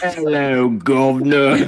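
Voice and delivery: put-on comic stretched-out British accent; mock British accent. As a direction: drawl it in hammed-up British. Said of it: "do this in a British accent".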